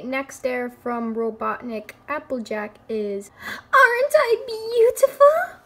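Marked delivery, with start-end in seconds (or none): 3.5-5.6 very high, loud preening; mock vain. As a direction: squeal it like a diva. Playing the dare's vain line.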